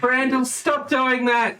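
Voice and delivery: high, drawn-out scolding; mock-alarmed parent telling off a child; scolding crowd. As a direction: call out slowly in exasperation. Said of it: "and everyone's like".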